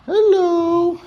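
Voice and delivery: squeaky high dog greeting; dog's voice. Pretend. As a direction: say hello as the dog, high and loud. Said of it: "Say hi".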